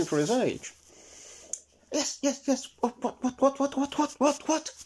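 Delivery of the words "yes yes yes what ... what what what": put-on high, quick, fussy old squirrel; jittery high squirrel babble; Mister Squirrel. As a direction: chatter in a high twitchy squirrel voice. Squirrel rushes down.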